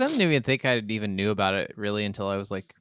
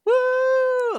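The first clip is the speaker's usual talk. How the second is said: a high, loud whoop; excited cheer; 6 dB louder than usual. Real, not acted. Host cheering the composer's name, high loud whoop.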